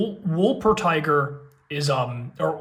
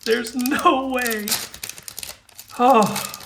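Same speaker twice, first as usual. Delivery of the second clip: awed disbelief; 6 semitones higher, much slower than usual. Real, not acted. Awed at pull.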